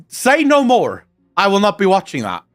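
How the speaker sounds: usual speaking voice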